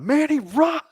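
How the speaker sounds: loud, very high yell; yelled warning. Acted. Player's character warns Manny to get out, yelling. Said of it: "I'm gonna yell out".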